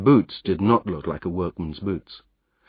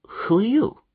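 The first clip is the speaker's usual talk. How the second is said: much higher, alarmed question; startled; 13 semitones higher than usual. Acted. Startled Jopley asks the grimy stranger who he is. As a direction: caught off guard by a stranger.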